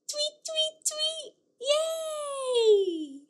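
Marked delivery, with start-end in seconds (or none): 0.1-1.4 high tweet tweet; bird tweets. Acted. Teacher chirping a bird call again.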